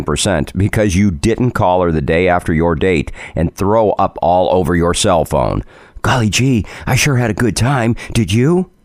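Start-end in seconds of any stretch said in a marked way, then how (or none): 6.0-8.7 put-on wholesome 1950s kid. Mock voice for a sweet caller.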